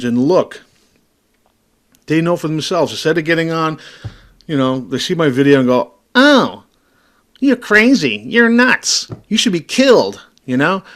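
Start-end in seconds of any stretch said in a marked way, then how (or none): 6.1-10.2 high, loud sneering mimicry of his detractors; mocking critics. Mocking the viewers who call him crazy, high sneering voice. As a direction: parrot the haters in a shrill, scornful squeal.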